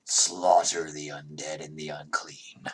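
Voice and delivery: low, slow, lightly voiced deity; booming deity voice. Game master as patron deity speaking in a player's head, low booming voice. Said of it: "this booming voice is in your head".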